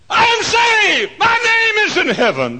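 very high, loud cry of joy; jubilant shout. Acted. Saved believer rejoicing that the name is in heaven, exultant yell. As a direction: yell it exultantly at the top of your range. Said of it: "It means, jump up and down".

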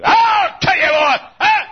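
high loud slow gruff bark; gruff shout. Acted. Preacher showing how people scold, gruff and loud. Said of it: "come with a gruff, like we do".

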